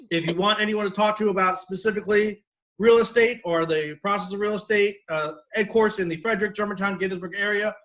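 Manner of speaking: normal talking voice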